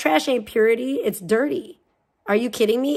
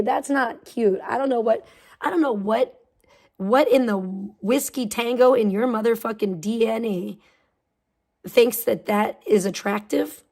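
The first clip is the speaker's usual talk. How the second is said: normal talking voice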